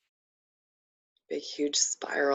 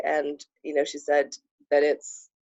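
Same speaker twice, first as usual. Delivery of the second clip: ordinary delivery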